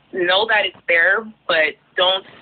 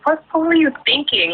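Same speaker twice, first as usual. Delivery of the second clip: mock scolding; 9 semitones higher than usual. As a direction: play the outraged daughter, pitch flying up. Scolding dad about trip.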